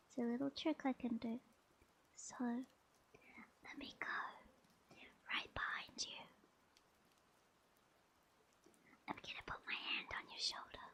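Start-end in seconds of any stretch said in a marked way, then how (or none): 2.2-10.9 soft, unvoiced ASMR whisper with long pauses; intimate whisper. Streamer putting a hand on listener's shoulder, whispering. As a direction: whisper it close, right behind the listener.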